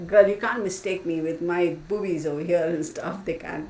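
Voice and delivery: usual speaking voice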